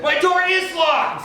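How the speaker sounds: very high, slow insistent reply; mock indignant reply. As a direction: answer back high and stubborn. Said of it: "So I said".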